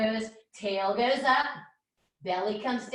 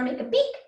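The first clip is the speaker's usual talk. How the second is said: chick beep; 6 semitones higher, 5 dB louder, faster than usual. Pretend. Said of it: "Go beep".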